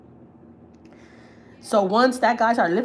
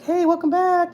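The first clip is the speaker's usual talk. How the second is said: much higher cheerful shop guy; friendly greeting; 11 semitones higher, 4 dB louder, slower than usual. Retold or imitated. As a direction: greet a returning regular brightly. Corner store guys greeting.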